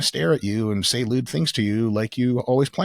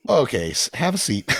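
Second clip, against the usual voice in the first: mock dismissal; 5 semitones higher, 4 dB louder than usual. Parent dismissing daughter.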